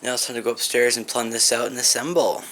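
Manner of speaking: normal talking voice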